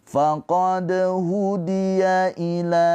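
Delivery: slow melodic tajwid chanting; Quranic recitation. Acted. Teacher chants the verse for students, slow and melodic.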